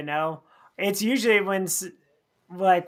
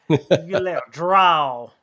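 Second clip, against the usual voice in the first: Southern drawl; slower than usual. Pretend. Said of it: "that Southern Belle drawl".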